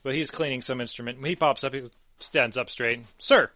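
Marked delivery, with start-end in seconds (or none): 3.2-3.5 loud, sharp "Sir!" like a soldier; snapping to attention. Acted. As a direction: bark it out standing up straight. Edward snaps to attention.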